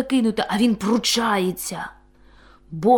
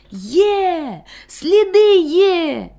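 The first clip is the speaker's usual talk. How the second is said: high, loud and slow joyful cry; joyful loud shout; 9 semitones higher, 5 dB louder, much slower than usual. A narrator's reading. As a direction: shout the find with joy. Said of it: "радісно й голосно вигукнув". (радісно й голосно, протяжно)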